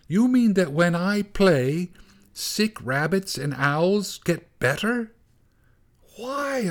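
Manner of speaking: startled. A narrator's reading. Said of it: "shouted Gorsh, startled".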